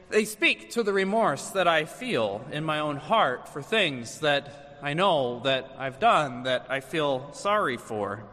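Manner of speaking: ordinary talk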